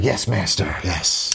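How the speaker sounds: low, groveling servant voice; hunchback assistant. Retold or imitated. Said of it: "he's like".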